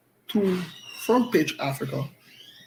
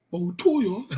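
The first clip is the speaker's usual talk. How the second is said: high-pitched, helpless laughter between phrases; words squeezed out through giggles; laughing; 8 semitones higher than usual. Real, not acted. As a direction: talk while cracking up for real. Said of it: "I hope that I laughed too much".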